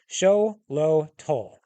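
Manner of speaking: Xolotl drawn out slowly, syllable by syllable. Acted. Hellhound to the twins, slowly spelling out its name. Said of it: "Let me say that slowly".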